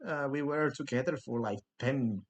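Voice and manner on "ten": ordinary talk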